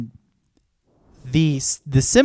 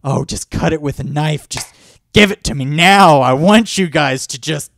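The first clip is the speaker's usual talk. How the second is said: hammed-up eager shouting, higher and louder; rising to a loud, excited mock cheer; 4 semitones higher, 10 dB louder than usual. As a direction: egg the couple on like a rowdy fan. Mock audience egging couple on.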